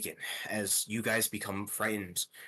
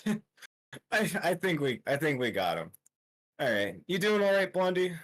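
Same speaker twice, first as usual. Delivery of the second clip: cocky hero; 8 semitones higher than usual. Acted. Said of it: "the purple one will look to the other and goes".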